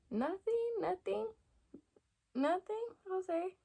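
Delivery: tiny high-pitched voice, imitating timidity; mock-timid high little voice; meek backing down. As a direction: squeak it out with rising, questioning ends.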